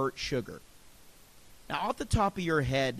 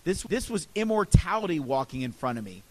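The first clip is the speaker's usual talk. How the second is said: ordinary delivery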